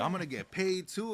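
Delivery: high, delighted retold exclamation; giddy pitch jump on "paid"; excited disbelief. Retold thrilled reaction to job offer.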